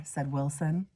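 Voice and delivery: ordinary delivery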